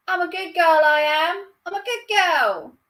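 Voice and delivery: high, put-on Cockney squawk; Eliza Doolittle. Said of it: "She's, like, proper Cockney".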